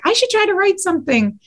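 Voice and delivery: high-pitched sudden resolve; inspired resolve. Retold or imitated. Wife proud of her writer husband, inspired resolve.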